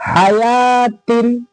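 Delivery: हयातिन very slow, long held "yaa", no "w"; careful model reading. Shown as a demonstration. Teacher modelling the word for students, slowly drawn out. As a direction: stretch out हयातिन syllable by syllable. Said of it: "क्या पढ़ेंगे?".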